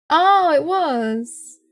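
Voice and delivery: drawn-out, high amused realization; surprised realization. Real, not acted. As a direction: stretch it out, delighted. Realizes teammate did it.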